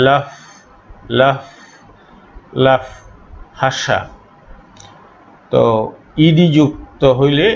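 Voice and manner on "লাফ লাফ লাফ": "লাফ" with air released through the lips on f; breathed-out F. Shown as a demonstration. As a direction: let the final f of "লাফ" hiss out as air. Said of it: "ঠোঁটের ফাঁক দিয়ে বাতাসটাকে বের করে". ("লাফ"-এর শেষে ঠোঁটের ফাঁক দিয়ে বাতাস)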